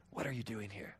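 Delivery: low, soft, gentle question; still small voice. Acted. God asking Elijah in a still small voice. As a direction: ask it softly and low. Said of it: "speaks to him in this still, small voice".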